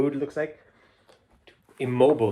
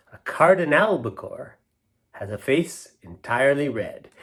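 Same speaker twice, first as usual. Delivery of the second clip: poem reading; 3 semitones higher, 5 dB louder than usual. Acted. Reading a silly poem aloud to the kids, rhythmic and lilting. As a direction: savour each line for the kids.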